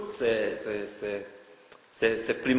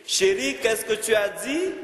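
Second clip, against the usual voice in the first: high, louder call to a husband; startled cry; 10 semitones higher, 4 dB louder than usual. Acted. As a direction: cry out as if jumping at his cough. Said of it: "je sursautte et je crie".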